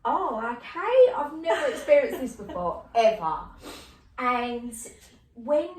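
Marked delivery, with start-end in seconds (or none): 0.0-3.4 replayed astonishment, played for comedy; bemused surprise. Retelling reaction to bizarre apparition.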